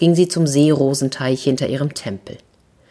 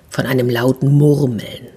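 ordinary delivery

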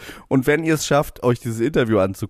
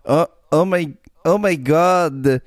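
put-on higher, slow German-Russian accent; 4 semitones higher, slower than usual. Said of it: "mach, mach mal bitte".

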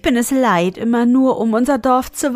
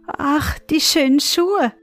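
put-on high, longing girlish sigh; delighted, yearning sigh; 6 semitones higher than usual. A narrator's reading. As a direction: breathe out "ach", then admire in a high voice. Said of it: "seufzte vor Lust".

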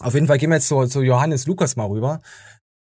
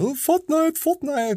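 put-on high, whining child begging; whiny kid voice; 13 semitones higher, slower than usual. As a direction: whine it like a game-obsessed kid. Nephew quoted begging for Fortnite.

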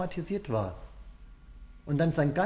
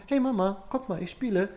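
put-on bright high child voice calling out to mama; playful child calling; 7 semitones higher, 4 dB louder than usual. Toddler in the play room calling to his mother, eager child voice. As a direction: call out like an eager two-year-old.

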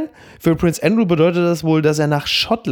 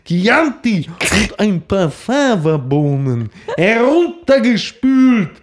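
Werner Hansch as Hannibal; 2 semitones higher, slower than usual. Retold or imitated. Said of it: "Oder Schweigen der Lämmer".

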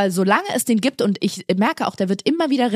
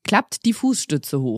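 put-on lower, slow du address to kids; casual announcement; 5 semitones lower, much slower than usual. Shown as a demonstration. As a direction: switch to talking to the children. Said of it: "erst siezen und dann duzen".

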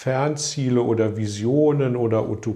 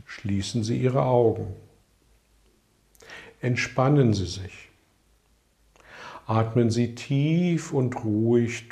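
right in your ear. Acted. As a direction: gently lead them into stillness. Guided relaxation exercise begins.

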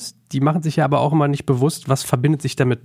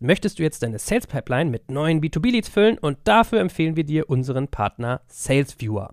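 energetic podcast ad voice; ad read; 2 semitones higher than usual. Acted. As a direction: sell it like a radio spot. Host reads sponsor ad.

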